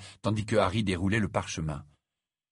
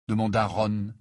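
plain reading voice

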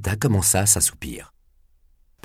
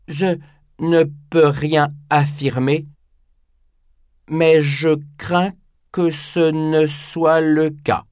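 put-on higher, slow, gentle old man; slow, humble elderly voice; 7 semitones higher, slower than usual. Nakata's voice in audiobook.

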